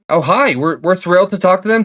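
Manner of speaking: usual speaking voice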